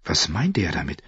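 usual speaking voice

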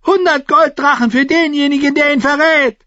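high, loud furious proclamation; shouting. A narrator's reading. King offering the crowd a reward, shouting high. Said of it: "rief er".

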